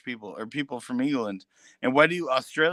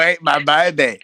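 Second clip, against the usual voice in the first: loud mock Australian accent; imitating Australians for friends, loud and hammed; 3 semitones higher, 12 dB louder than usual. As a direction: ham up an Aussie voice, much louder. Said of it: "They go".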